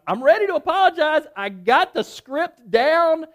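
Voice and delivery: loud, high self-psyching; worked-up readiness. Retold or imitated. Man psyching himself up to apologize, loud worked-up voice. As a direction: pump yourself up, stretching the last words.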